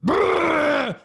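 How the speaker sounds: loud, high retching sputter; retching noise. Retold or imitated. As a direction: spit out a heaving sound. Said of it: "People are purging".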